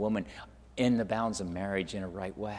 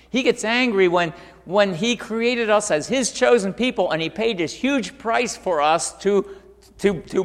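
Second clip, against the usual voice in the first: raised, louder preacher's voice; forceful preaching; 9 semitones higher, 9 dB louder than usual. Acted.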